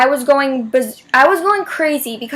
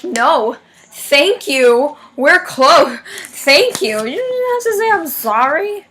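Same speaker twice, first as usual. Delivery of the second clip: higher, animated mock-grateful protest; gushing reply; 4 semitones higher, 4 dB louder, slower than usual. Telling ladies who apologized for the seats, gushing. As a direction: play up an insistent thank-you, pitch swooping high.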